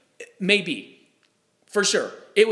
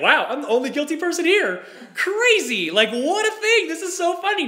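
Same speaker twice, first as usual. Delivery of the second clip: mock amazement; 8 semitones higher than usual. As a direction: play up being amazed you're the only guilty one. Mock amazed at himself in court.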